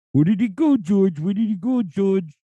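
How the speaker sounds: high cartoon vulture whine. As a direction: play the Looney Tunes vulture. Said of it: "he was all like".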